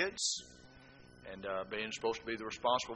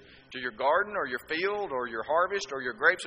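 ordinary talk, nothing marked

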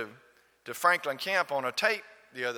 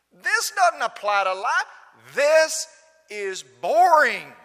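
put-on scornful young person; 11 semitones higher, 9 dB louder than usual. Preacher voices a scornful unchurched youth.

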